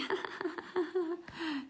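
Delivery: put-on high, soft giggling of two girls; secretive high titter, demonstrated; girls' giggle. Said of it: "girls in the corner going".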